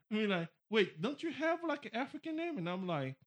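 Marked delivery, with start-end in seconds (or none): 0.7-2.6 mock questioner. Mocks a questioner's disbelief.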